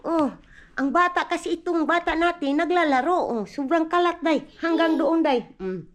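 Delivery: ordinary delivery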